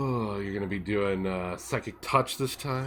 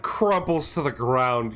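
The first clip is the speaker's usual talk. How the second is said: ordinary talk, nothing marked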